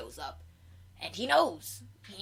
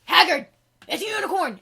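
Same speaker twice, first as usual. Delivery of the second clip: high, loud cry of alarm; king spotting the unicorn, shouting; 9 semitones higher, 13 dB louder than usual. Retold or imitated. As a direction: yell it out in sudden shock.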